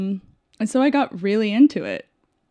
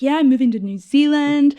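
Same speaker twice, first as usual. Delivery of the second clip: higher, louder happy announcement; retold breezy excitement; 6 semitones higher, 8 dB louder than usual. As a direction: announce it proudly, playing up the excitement. Retold boast to brewery colleague.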